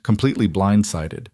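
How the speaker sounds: plain reading voice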